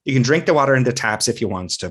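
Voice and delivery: imitated Irish-sounding Newfoundland accent. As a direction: do the local Newfoundland accent on the whole line. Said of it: "I'll butcher it".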